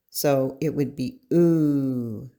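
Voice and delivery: oo drawn out slowly like in food; oo held long and round; slow oo sound. Shown as a demonstration. Teacher showing learners the oo sound, slow and held. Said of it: "the U sounds like oo".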